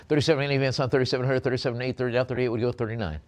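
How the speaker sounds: rapid auctioneer patter calling the price; auctioneer bid call. Acted. Auctioneer calling bids to online bidders, fast patter. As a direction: rattle off the bids like a live auction.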